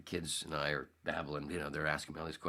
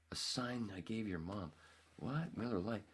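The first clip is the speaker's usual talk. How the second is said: puzzled disbelief; 6 semitones higher, 5 dB quieter than usual. Retold or imitated. Acts out his puzzled disbelief.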